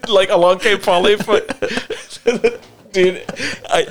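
high, laughing mock shout; high playful cry between laughs; laughing callout. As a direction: yell it through laughter.

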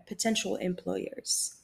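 normal talking voice

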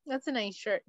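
subdued, offhand praise; insincere compliment. Acted. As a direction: toss it off quietly, without enthusiasm. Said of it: "just to be like".

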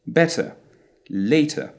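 Better and later with a very short uh ending, no R; short final sound. Shown as a demonstration. Teacher correcting a misconception for learners, short final sound. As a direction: clip only the endings of Better and later, dropping the R. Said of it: "actually a very short sound at the end".

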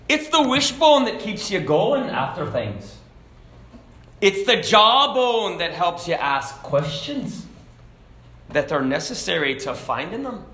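aged Irishman with a wobbly brogue; Irish accent sliding into Scottish. Acted. Old man explaining the three bones, Irish-Scottish accent. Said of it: "I went from Irish to Scottish there".

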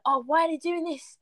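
hammed-up outraged viewer; mocking fans. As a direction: sneer it like a petty fan. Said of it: "the audience took the mick".

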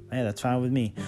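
ordinary delivery

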